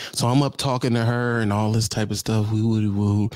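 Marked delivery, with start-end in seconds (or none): none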